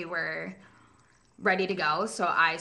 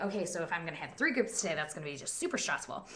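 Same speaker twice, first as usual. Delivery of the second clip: stressed inner voice; 5 dB quieter, faster than usual. Retold or imitated. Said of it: "So I'm like".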